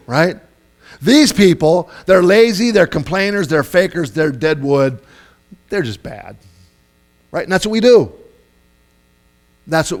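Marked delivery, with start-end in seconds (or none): 1.0-5.0 mock judgmental tirade; acted contempt. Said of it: "here's what we tend to think". 5.7-6.4 low dismissive verdict; mock judgmental finality; acted contempt. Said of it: "here's what we tend to think".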